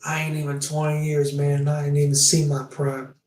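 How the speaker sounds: ordinary talk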